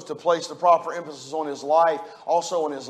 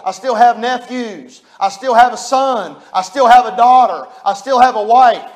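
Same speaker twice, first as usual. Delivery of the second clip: louder and rising, the same phrase hammered line after line; preaching at full force; 3 semitones higher, 6 dB louder than usual. Acted. Pastor to his congregation on loving family, preaching full force. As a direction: preach it, leaning hard on every "have". Preaching the grandson's thoughts aloud.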